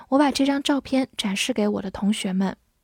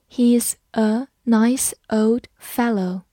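slow, careful read of the sentence; slower than usual. Shown as a demonstration. Teacher reading slowly for students to follow. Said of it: "慢慢来读".

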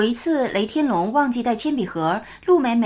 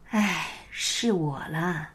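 put-on slow, soft, lower sighing old voice; weary sighing Buddha; 4 semitones lower, 6 dB quieter, slower than usual. A narrator's reading. Buddha answers the firefly with a long, weary sigh. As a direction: let out a long sigh, then speak slowly. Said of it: "就听到一声长长的叹息".

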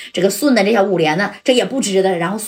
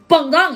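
explosion noise; 6 semitones higher than usual. Retold or imitated. Narrator mimics the grenade going off, sharp bang. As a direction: burst it out like a blast.

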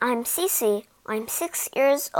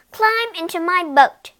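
higher commanding mosquito voice; bossy mosquito; 6 semitones higher, 4 dB louder than usual. A narrator's reading. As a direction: order him into the boat. Mosquito ordering.

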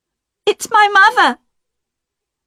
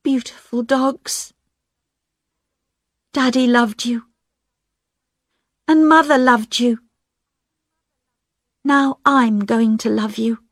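tender to dogs; 5 semitones higher than usual. A narrator's reading. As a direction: comfort the dogs gently. Diane talks to dogs.

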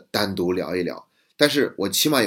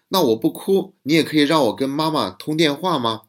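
lightly voiced child, higher, asking hopefully; a hint of a small kid testing the deal; 6 semitones higher than usual. Teacher retells the boy asking to call mom, lightly voiced child. Child bargaining after crying.

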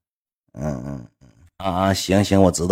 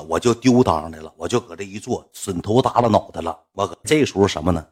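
ordinary delivery

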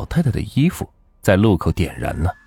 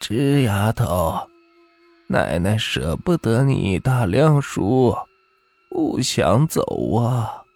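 put-on very slow, drawn-out old woman's voice; old granny; much slower than usual. A narrator's reading. Dead granny tells the child she cannot bear to leave, slow old voice. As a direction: stretch each word like a lingering aged grandmother. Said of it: "洪奶奶说道".

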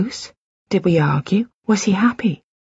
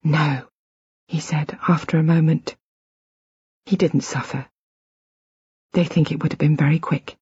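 soft near-whisper; 2 semitones lower, 3 dB quieter than usual. Acted. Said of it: "almost whispering".

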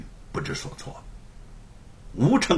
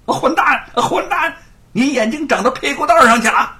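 high, loud, fast scolding; angry curse; 11 semitones higher, 7 dB louder than usual. A narrator's reading. Chief constable slapping his clumsy man: furious scolding. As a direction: curse the fool out. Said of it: "气恼地骂道".

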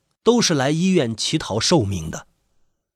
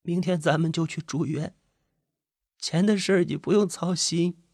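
soft, higher tearful reassurance; wife's small, high, crying voice; 5 semitones higher, 5 dB quieter than usual. A narrator's reading. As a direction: reassure him through tears. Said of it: "李早哇的一声哭了起来".

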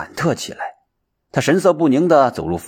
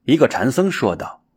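usual speaking voice

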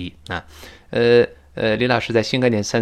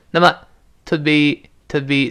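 measured, higher-pitched teaching take of "to be"; slow careful demo; 4 semitones higher than usual. Shown as a demonstration. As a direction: say "to be" slowly and separately. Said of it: "在这一带儿过没的".

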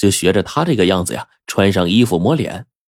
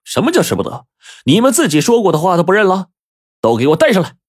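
high-pitched, indignant challenge; commanding shout; 10 semitones higher, faster than usual. Acted. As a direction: call them out, then give the order. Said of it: "这五爷站起来大喝一声".

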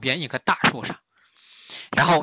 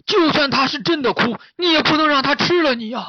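very high, loud character voice; urgent warning; 13 semitones higher, 7 dB louder than usual. Acted. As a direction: plead loudly with the fawn to stay back. 大师拦住小鹿劝阻. (大师尖声急劝)

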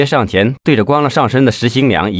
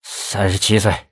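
hoarse voice; 5 dB quieter than usual. A narrator's reading. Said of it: "一个沙哑的声音".

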